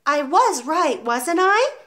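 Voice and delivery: put-on high, slow, unsure little boy; anxious schoolboy seeking reassurance; nervous question. A narrator's reading. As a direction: ask nervously, voice rising at the end. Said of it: "he murmured nervously".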